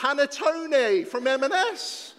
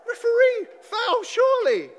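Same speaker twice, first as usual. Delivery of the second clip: shouting at referee; 10 semitones higher, 6 dB louder than usual. Acted.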